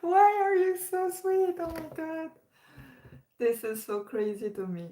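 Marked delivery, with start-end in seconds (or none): 0.0-2.3 high, loud gushing squeal, then trailing off. Retold or imitated. Retelling her reaction to sweet viewer comments, high excited gushing. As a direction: gush like a moved fan overwhelmed by kind comments. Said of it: "I was like".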